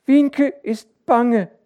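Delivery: sehr hoch, laut und langsam; ängstliche Kleinkindstimme, gedehnt; banges Kind. A narrator's reading. Kind gesteht seine Angst. (kindlich bange)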